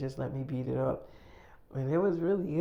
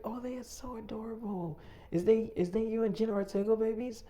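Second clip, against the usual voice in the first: cooing woman's voice; 7 semitones higher than usual. Retold or imitated. ASMR woman gushing over his babies, cooing higher. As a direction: coo at them like a doting fan.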